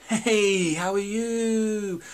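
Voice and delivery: high, bright mock "Hey, how are you?"; fake cheery greeting. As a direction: mimic a gushing over-friendly hello. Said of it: "kind of way of talking".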